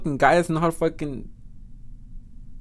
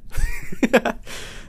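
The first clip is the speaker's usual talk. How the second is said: high, breathy giggle; laugh; 10 semitones higher than usual. Real, not acted. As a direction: giggle at your own remark. Laughs at own remark.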